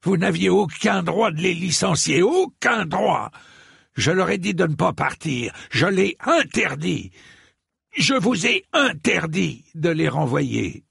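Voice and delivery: high-pitched outraged rebuke; vehement, indignant scolding; vehement fury. A narrator's reading. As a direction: lay into him, hammering the forbidden words. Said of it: "s'en prit à Dunk avec véhémence".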